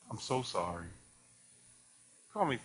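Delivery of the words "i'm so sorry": somber doctor. Retold or imitated. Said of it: "The doctor said to me".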